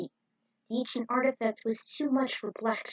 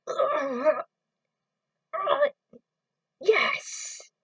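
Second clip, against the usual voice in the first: high, hesitant put-on voice stumbling to a yes; stammering henchman; 5 semitones higher, much slower than usual. Henchman answers his master, a high nervous stammer. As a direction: stall nervously, then squeak out yes.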